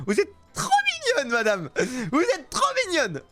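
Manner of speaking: high, squealing mock adoration; exaggerated gushing. Seller to odd-faced customer, hammed-up gushing. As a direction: gush as if overcome by cuteness.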